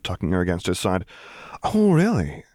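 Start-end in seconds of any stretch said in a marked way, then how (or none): 1.6-2.4 higher, sly "Oh, really?"; coy mocking purr; purring tease. A narrator's reading. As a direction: purr it at him, challenging. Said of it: "she purred".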